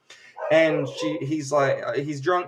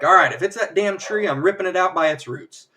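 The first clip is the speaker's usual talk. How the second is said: louder, higher mock exasperation; fed up; 5 semitones higher, 5 dB louder than usual. Husband fed up with that tree, mock exasperated outburst. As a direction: rant like someone who has had it. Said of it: "Vision's had it".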